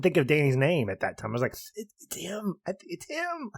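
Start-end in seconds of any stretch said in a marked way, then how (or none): 1.8-3.5 retold high, hushed squeal of recognition; excited whisper. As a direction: whisper it, too excited to finish. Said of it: "And I was so excited".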